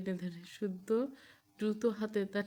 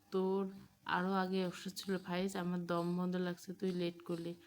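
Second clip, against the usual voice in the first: plain reading voice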